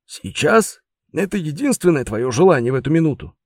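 high, disbelieving repeat; incredulous. Acted. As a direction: press the question in disbelief.

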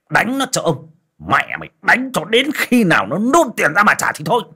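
high, loud enraged landlord; a moneylender exploding in rage at a debtor; furious tyrant. Acted. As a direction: roar the orders at the servants. Moneylender erupts and orders beating.

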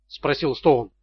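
plain reading voice

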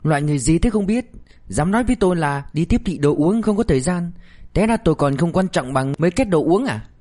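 narrator's mildly acted anger; through gritted teeth. A narrator's reading. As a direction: vent wounded pride, teeth clenched. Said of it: "nghiến răng nói".

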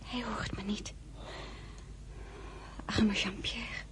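slow, grieving whisper; woman whispering over a dying general. Acted. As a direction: hush your voice at the deathbed. Beside a dying soldier.

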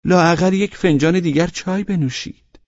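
reproachful hostess urging; complaining plea. Acted. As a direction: whine gently that the guest stay.

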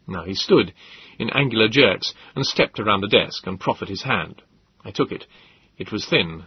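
plain reading voice

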